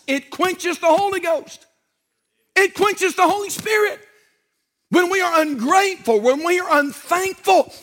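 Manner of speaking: preacher building from emphatic to shouted fire; rising preaching. Acted. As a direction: build the sermon to a fiery peak. Preaching builds to full force.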